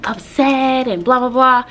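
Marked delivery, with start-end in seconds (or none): none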